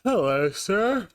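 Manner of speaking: high, slow mock-formal greeting; mock soldier. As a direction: salute him with slow formality. Greeting drill sergeant mockingly.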